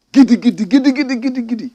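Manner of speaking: loud, high-pitched "giddy giddy" rattle; mock chant. Commentator mimics the chiefs' show of power, mock chant. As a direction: fire off mock incantation syllables, dropping at the end.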